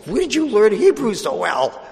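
high-pitched astonished question; amazed student. Retold or imitated. Helped student asking how he learned Hebrew, amazed.

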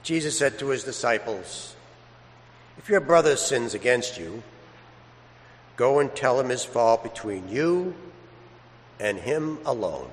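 gospel reading. Acted. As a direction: read it reverently, unhurried, to the congregation. Priest proclaims the Gospel.

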